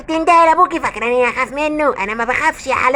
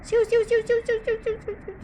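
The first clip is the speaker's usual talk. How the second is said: high fading ghost squeak; 8 semitones higher, 10 dB quieter, faster than usual. Acted. Skull ghost flees, fading away.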